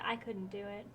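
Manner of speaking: ordinary talk, nothing marked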